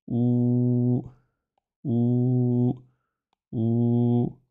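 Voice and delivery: "Ou" held as a long rounded u; long ou vowel. Shown as a demonstration. Teacher to pupils, showing the long vowel, drawn-out "Ou". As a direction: stretch "Ou" out for the class. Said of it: "il fait le son ou".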